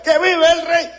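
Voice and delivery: high, joyful cheer; happy cheer. Retold or imitated. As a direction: shout it like the happiest marcher. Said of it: "el más feliz del desfile".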